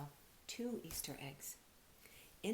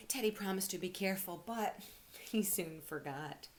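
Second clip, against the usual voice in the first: plain reading voice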